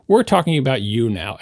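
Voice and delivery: low, firm brush-off; stern reviewer. Retold or imitated. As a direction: bring it back to you, coldly. Mock reviewer repeats line.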